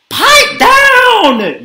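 high, loud, annoyed yell. A narrator's reading. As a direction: yell at the noisy singer. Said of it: "yelled a mouse".

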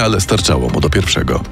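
plain reading voice

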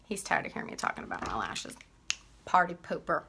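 a quick aside about him; low teasing aside. Real, not acted. Teasing husband offscreen.

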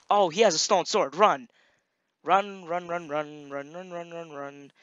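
panicked yelling, fading off; urgent panicked run chant; being chased. Real, not acted. Fleeing armed player in game.

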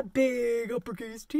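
big, full, stretched BIG; big-letter voice. Shown as a demonstration. Teacher shows the uppercase T, big stretched voice. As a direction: stretch out "big" and sound large for T.

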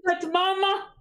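high, crying disbelief; tearful surprise. Acted. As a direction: cry out as he sees his mother. Son recognizes his lost mother.

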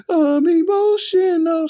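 high, loud, slow whiny mockery of an upset fan; mock emotional fan. Vlogger mocking an emotional fan, whiny high wail. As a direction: sneer it out like a crybaby.

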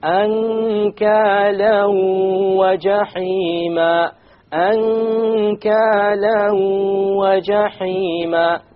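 Ankala wa jahima chanted high and very slow, tanween merged into waw; recited with gunna. Shown as a demonstration. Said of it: "You merge the tanween along".

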